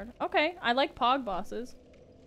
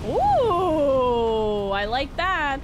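loud, delighted ooh; excited; 3 semitones higher, 9 dB louder, much slower than usual. Real, not acted. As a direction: cheer at the loot. Excited reaction.

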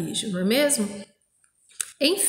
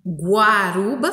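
guaruba slowly, with GUA drawn out so the U is heard; stressed sound demo; 3 dB louder, slower than usual. Shown as a demonstration. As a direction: stretch the opening GUA of guaruba. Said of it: "não conseguiu ouvir o som".

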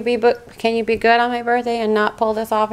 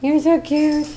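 cooing pet talk; 6 semitones higher, slower than usual. Real, not acted. Owner cooing at her cat in a high baby voice. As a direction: coo it up high like talking to a kitten.

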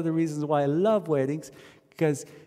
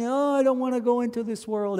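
higher, whiny newborn's protest; mock wailing baby; 9 semitones higher than usual. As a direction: play the crying baby lightly. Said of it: "you came wailing and crying".

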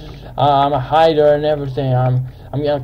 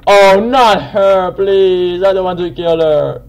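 dismayed pleading; 5 semitones higher, 7 dB louder, slower than usual. Real, not acted. Dismay at having to kill her.

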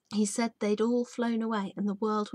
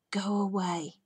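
faint wheezy growl. A narrator's reading. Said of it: "His growl was a faint little wheeze".

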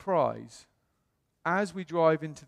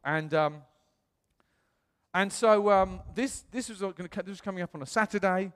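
usual speaking voice

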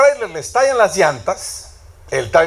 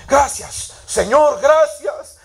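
much higher, stretched cry of thanks to the Lord; fervent thanks; 8 semitones higher, much slower than usual. Retold or imitated. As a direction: lift it up like a heartfelt relieved prayer.

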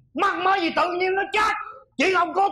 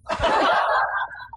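gloating laugh. Acted. Gloating after the quarrel.

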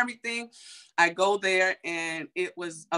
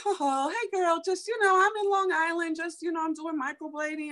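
put-on much higher, bubbly girlfriend voice; imitating Stacy; 11 semitones higher than usual. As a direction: put on a breezy, high sing-song friend voice. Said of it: "She was like".

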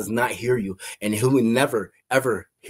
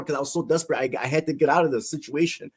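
ordinary delivery

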